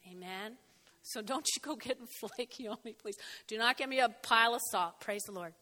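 usual speaking voice

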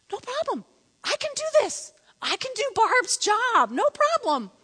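very high mimicking voice; comic high-pitched husband impression; cocksure husband.

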